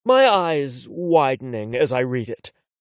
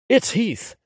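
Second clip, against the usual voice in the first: ordinary delivery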